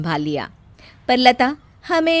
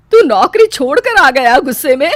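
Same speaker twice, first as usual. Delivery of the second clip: loud, higher outcry; can't believe he quit; shocked disbelief; 5 semitones higher, 10 dB louder than usual. Acted. Mother hears son quit.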